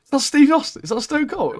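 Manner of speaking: imitating an excited kid, very high-pitched; excited son. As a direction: squeal the question like a starstruck son. Retells young son's excited question.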